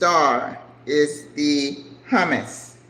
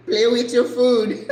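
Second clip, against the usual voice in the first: laughing; 6 semitones higher, 6 dB louder than usual. Real, not acted. Laughing while cooking.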